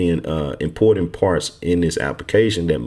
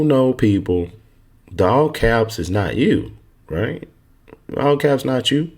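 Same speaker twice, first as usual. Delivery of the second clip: normal talking voice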